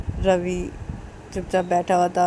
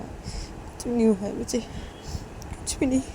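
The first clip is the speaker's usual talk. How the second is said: slow, softer, breaking into a whispered sob; fading into sobs; 4 semitones higher, 4 dB quieter, slower than usual. Acted. Shruti trails off crying to her brothers, slow whisper. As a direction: let the denial die away in tears. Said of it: "ऐसे रोए जा रही थी".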